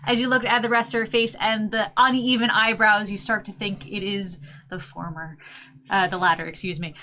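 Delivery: ordinary delivery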